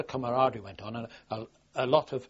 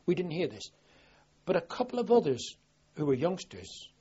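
usual speaking voice

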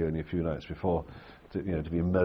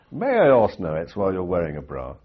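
loud sergeant major; 9 dB louder than usual. Retold or imitated. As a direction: boom it out with stiff courtesy. Sergeant major's mock-formal question.

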